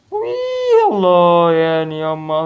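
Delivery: high, loud squeal of joy; wild happy shriek, then loud cheering; excited whoop. Real, not acted. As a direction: burst out in triumph. Finally succeeds in the game.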